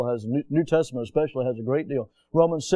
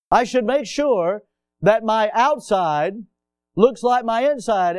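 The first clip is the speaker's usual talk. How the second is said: louder, higher, slowed restatement; preaching emphasis; 8 semitones higher, 5 dB louder, slower than usual. Shown as a demonstration. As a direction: repeat it with full preaching force. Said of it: "repeated line, pressed".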